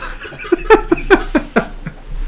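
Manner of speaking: real high, wheezy burst of laughter; long uncontrolled giggling laugh; laughing fit. Real, not acted. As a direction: crack up helplessly at the joke. Cracks up at the joke.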